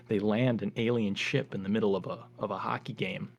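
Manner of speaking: ordinary delivery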